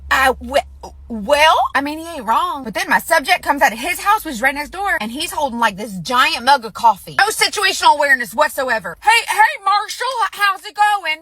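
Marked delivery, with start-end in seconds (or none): 0.1-1.6 halting, stammered attempts at a comeback; stunned stammer. Wife called out by her husband, mock stunned stammer. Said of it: "she just looking stunned". 9.0-11.2 high-pitched mock call to a neighbor; neighborly greeting. Neighbor with coffee greeting Marshall, high friendly call.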